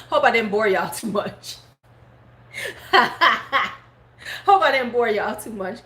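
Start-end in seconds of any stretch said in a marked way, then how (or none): 0.0-3.7 said through laughter; laughing. Real, not acted. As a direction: giggle while apologizing. Laughing at end of story.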